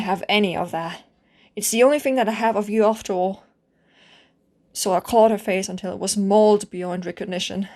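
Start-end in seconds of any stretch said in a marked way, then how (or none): none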